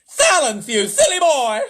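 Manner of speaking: put-on high, loud, strict old nanny; old nanny scolding the president; stern Miss Tibbs. A narrator's reading. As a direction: scold him like a naughty child. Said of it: "I've gotta do Miss Tibbs now".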